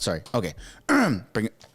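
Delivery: normal talking voice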